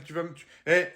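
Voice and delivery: usual speaking voice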